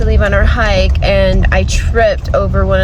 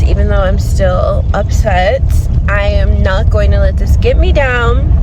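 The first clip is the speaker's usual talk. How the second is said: ordinary talk, nothing marked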